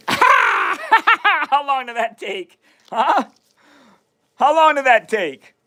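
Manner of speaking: high-pitched, cracking up between words; helpless laughter breaking into the questions; real laughing. Real, not acted. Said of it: "Why I'm laughing".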